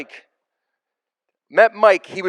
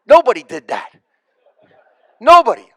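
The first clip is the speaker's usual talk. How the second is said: normal talking voice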